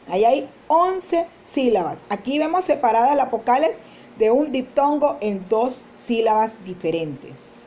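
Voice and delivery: normal talking voice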